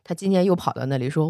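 ordinary delivery, nothing marked

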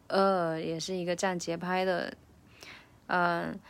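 ordinary talk

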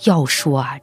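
ordinary delivery

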